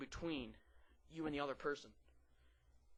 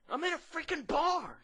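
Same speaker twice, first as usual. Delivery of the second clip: high, loud protest; mock indignant retort; 13 semitones higher, 8 dB louder than usual. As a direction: yell back in exaggerated indignation.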